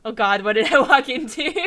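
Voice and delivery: loud taken-aback remark; shocked aside; disturbed. Real, not acted. Player reacts.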